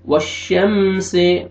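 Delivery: वश्शम्सि with the meem said clearly, not through the nose; वश्शम्सि slow, no nasal hiding of the meem. Shown as a demonstration. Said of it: "इख्फा नहीं करेंगे". (वश्शम्सि में मीम साफ़, नाक में आवाज़ नहीं)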